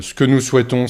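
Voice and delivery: normal talking voice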